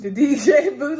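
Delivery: amused echo of D J booth; laughing repeat. Real, not acted. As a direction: laugh it out in disbelief. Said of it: "he don't understand that".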